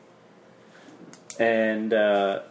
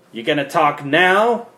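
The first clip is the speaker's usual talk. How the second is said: higher, louder mocking jab; taunting interrogator; 6 semitones higher, 8 dB louder than usual. Interrogator taunting the beaten captive, loud and mocking. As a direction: lean in and mock him.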